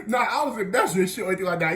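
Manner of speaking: hurried mock-defensive protest; retold protest. Student denies teacher's accusation.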